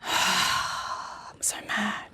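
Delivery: slow, breathy whisper of real annoyance; sigh, then breathe the words out slowly; whispered frustration. Real, not acted. Gamer who forgot the shop closed, whispering in frustration.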